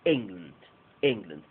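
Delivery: strong EN, weak schwa land; stress on EN. Shown as a demonstration. Teacher modelling England twice for the students. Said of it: "Toda la fuerza de voz en en".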